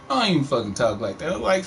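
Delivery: ordinary delivery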